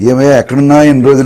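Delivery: loud theatrical welcome, laughing through it; hammed-up guru greeting a long-lost disciple; dramatic greeting. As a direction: throw it out like a stage line with a laugh. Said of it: "నాటకీయమైన డైలాగే అది".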